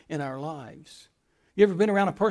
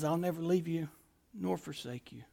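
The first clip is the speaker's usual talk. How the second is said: softer, near-hushed voice of God; hushed promise; 4 semitones lower, 13 dB quieter than usual. Acted. Preacher softly voicing God's comfort to the fearful congregation. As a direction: lower it to a tender hush.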